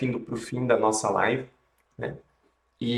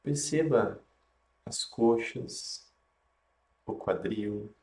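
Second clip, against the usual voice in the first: guided meditation; 3 dB quieter than usual. Acted.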